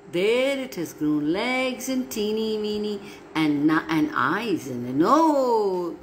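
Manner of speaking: ordinary delivery, nothing marked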